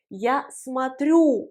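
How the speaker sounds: смотрю slowly, stressed on the final ю; stress on YOU. Shown as a demonstration. Teacher showing learners the accent, смотрю stressed on ю. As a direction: lean long on the "you" ending of смотрю. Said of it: "l'accent sur le you".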